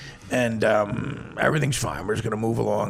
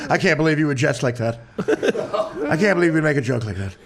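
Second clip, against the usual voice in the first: mock pouty complaint, raised and loud; pouting; 4 semitones higher, 4 dB louder, faster than usual. Manager complaining to his client about a joke, imitated pouting. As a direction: imitate a hurt manager with a pout. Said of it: "And he pouted. He goes".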